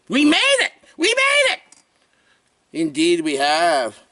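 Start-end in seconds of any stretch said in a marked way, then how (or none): 0.1-0.7 squeaky cheer. Acted. Triumphant celebration. 1.0-1.6 squeaky cheer. Acted. Triumphant celebration.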